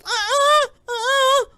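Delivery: very high, loud squealed denial; mock kid whine. Playing Drake denying it to his dad, squealed mock whine. Acting the kid's wordless denial.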